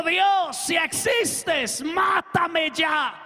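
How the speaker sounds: loud, slow, defiant yell at the sky; shouted challenge. Retold or imitated. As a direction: dare God out loud, dragging each word. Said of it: "gritó duro y dijo". (grito desafiante y lento al cielo)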